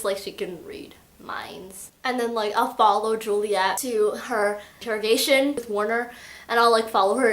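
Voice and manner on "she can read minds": slow, hushed voiceless reveal; acted whisper. Kenji reveals his sister's power, in an acted whisper. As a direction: whisper it slowly like a secret.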